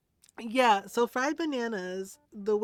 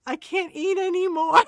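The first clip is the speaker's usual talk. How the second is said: loud, high hammed-up wail; mock desperate plea; 8 semitones higher, 10 dB louder than usual. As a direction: imitate someone begging to be spared food. Imitating partner pleading for help.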